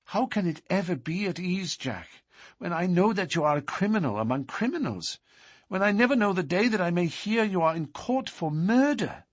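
put-on frightened young woman's voice; Etty. A narrator's reading. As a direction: pour out her fear. Etty fears he is criminal.

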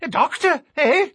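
high, loud excited outburst; nervous little man crying out in excitement. A narrator's reading. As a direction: cry it out, thrilled. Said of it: "cried he, much excited".

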